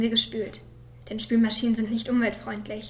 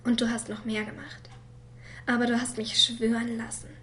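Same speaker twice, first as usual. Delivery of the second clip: ordinary talk, nothing marked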